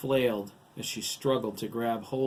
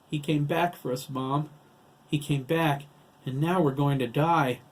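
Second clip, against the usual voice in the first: raised, louder voice of dread; distressed, higher and louder; fearful outburst; 5 semitones higher, 6 dB louder than usual. A narrator's reading. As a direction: plead with Mom in rising panic. Frightened boy tells mother they'll die.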